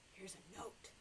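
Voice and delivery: hushed reveal; whisper. Real, not acted. Librarian shows the children the note, whispering. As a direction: whisper it like a secret.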